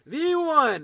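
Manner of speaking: loud, high drawn-out announcer call; fighting game announcer. Pretend. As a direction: boom it out for the crowd. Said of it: "get my announcer voice on".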